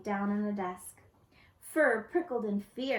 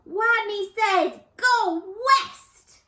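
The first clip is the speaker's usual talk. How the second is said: very loud, high, slow call; voice so strong; 11 semitones higher, 11 dB louder, much slower than usual. A narrator's reading. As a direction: boom it across the playground. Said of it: "In a voice so strong".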